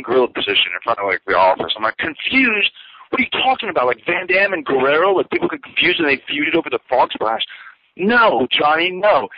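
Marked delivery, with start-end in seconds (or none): 2.0-9.3 high, mocking disbelief; exasperated retort. Arguing with the boss in gorilla position, exasperated and high. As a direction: ridicule his excuse.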